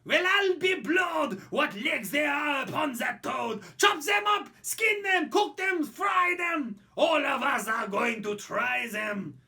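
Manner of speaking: high, loud mob screaming for toad legs; screaming French crowd. Acted. Townsfolk scream at the giant toad, loud and high. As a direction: bellow it like a hungry mob waving knives. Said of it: "They screamed in French".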